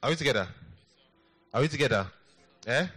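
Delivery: loud rallying call to the crowd; preacher's shout. Real, not acted. Pastor shouting to rouse the congregation, loud and rallying. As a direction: throw it out to the back row.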